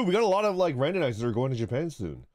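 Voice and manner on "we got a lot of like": normal talking voice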